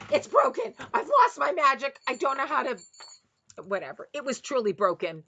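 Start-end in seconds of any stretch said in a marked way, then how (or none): 0.1-0.7 "broken" shot up high and loud in dismay. Retold or imitated. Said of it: "I couldn't believe". 0.9-2.8 high, loud wail of mock despair; hammed-up horror at a broken bell; dismayed disbelief. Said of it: "I couldn't believe".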